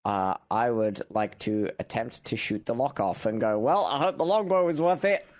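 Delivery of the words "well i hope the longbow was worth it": high, raised acted shout; pitched-up, sardonic holler; in-character call. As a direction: call it out over the danger. Player calls out in character.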